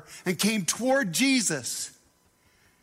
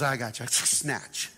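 calm God; 8 semitones lower, 3 dB quieter than usual. Acted. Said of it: "And God goes".

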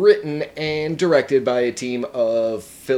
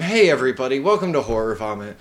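podcast host intro; 4 semitones higher, faster than usual. Acted. Show intro.

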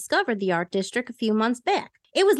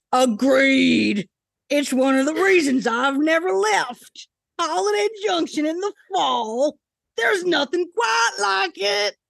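strained, pushed-out male voice, higher; strained character voice; 5 semitones higher than usual. Acted. Reader doing Darren's voice, strained and constipated. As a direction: squeeze the words out like he's straining. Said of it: "Now he sounds constipated".

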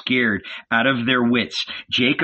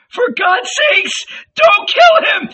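very high, loud desperate plea; frantic high-pitched cry; 7 dB louder than usual. A narrator's reading. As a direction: shriek it as a terrified mother. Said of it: "she cried in her high voice".

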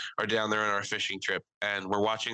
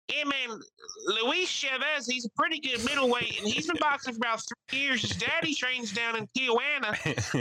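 Mike's voice; 13 semitones higher than usual. Retold or imitated. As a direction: lay out boxing trivia like a country expert. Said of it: "Mike being like".

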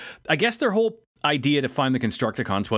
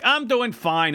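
strained high claim; 9 semitones higher, slower than usual. Retold or imitated. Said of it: "B's like".

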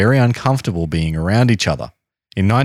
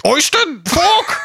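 put-on posh British accent; slower than usual. Mocking posh British manners.

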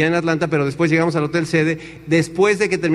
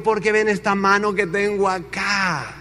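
slow, drawn-out showman's brag; boastful American speaker; 3 dB quieter, slower than usual. Retold or imitated. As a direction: stretch each phrase grandly. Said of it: "se arranca este americano diciendo".

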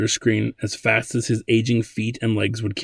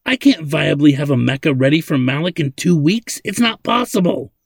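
put-on raised, protesting old man; hollering; 5 semitones higher, 4 dB louder than usual. A narrator's reading. Old man refuses the two-week job, hollering. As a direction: protest it with voice climbing at the end. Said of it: "he hollered".

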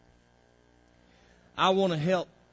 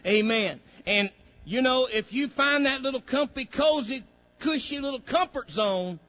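loud, higher, driving preacher's call; full-force preaching; 7 semitones higher, 8 dB louder than usual. Acted. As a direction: preach it hard to the congregation.

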